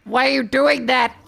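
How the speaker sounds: loud, high mock-alarmed questioning; exaggerated loud outburst of concern; alarmed demanding. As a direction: imitate a panicked listener, almost shouting. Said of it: "someone would be like".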